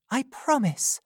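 pleading Rose. A narrator's reading. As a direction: ask her not to go back. Rose promising.

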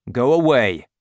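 loud, firm order; gruff dismissal. Acted. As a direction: send the child off sharply. Valjean dismisses the boy.

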